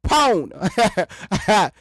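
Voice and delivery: Pahona very slowly, puffed P, clear H; slow sounded-out PH. Shown as a demonstration. Mocking silent letters, slowly says phone as Pahona. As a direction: stretch Pahona out syllable by syllable. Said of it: "If you wanna put that P H on there".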